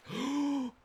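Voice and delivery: gasp. Real, not acted. Finds the Electrum orb.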